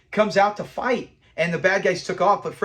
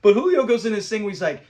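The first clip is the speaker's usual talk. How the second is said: normal talking voice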